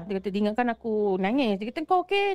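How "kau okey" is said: concerned friend. Retold or imitated. Friend asks worried.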